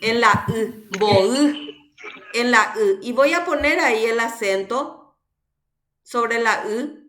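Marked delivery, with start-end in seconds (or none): 1.0-1.9 louder MBO'Y, final Y lengthened and rising; greater intensity on stress. Shown as a demonstration. Teacher repeats the word for students, stressed demonstration. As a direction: punch the end of MBO'Y. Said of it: "Dónde pronuncio con mayor intensidad".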